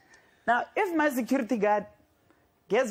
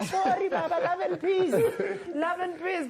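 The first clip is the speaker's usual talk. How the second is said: higher, pleading, mock-meek peacemaker; meek fan pleading; 8 semitones higher than usual. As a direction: beg for peace in a small high voice. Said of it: "Chiefs are like".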